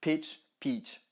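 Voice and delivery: short i in Pitch, long stretched i in peach; pitch versus peach. Shown as a demonstration. Teacher demonstrating the short and long i to students. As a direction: clip the Pitch vowel, hold the peach vowel. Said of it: "il y en a un qui est court et l'autre qui est long".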